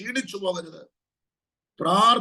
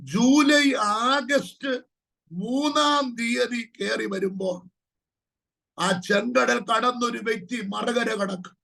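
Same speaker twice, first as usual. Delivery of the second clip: ordinary talk, nothing marked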